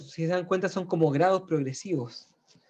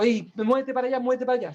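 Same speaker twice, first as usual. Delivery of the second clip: agitated; 7 semitones higher, faster than usual. Acted. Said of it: "mucho movimiento".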